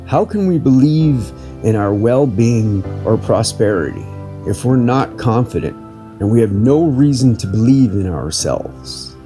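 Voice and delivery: ordinary talk, nothing marked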